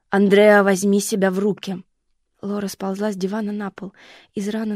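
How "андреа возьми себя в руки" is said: громкий резкий окрик; встряхни её криком, громче; крик матери. Acted. Лора кричит на дочь. (мать громко окликает застывшую дочь, резкий крик)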